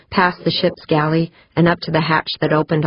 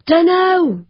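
much higher, raised voice; George's reply; 13 semitones higher, 4 dB louder than usual. A narrator's reading. George answering Pancho over her earphones, high raised voice. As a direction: call it back high over the radio. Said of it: "George's voice replied".

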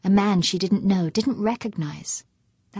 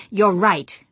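loud, curt snap, louder than usual; snapped; 8 dB louder than usual. Acted. Fuchs cutting off his wife's apology, loud curt snap. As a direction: cut her off sharply. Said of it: "he snapped".

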